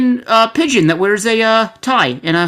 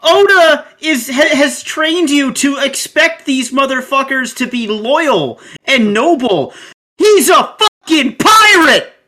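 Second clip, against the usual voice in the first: worked-up freak out; 4 semitones higher, 4 dB louder than usual. Real, not acted. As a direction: hammer it home in a loud rant. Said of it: "calm down".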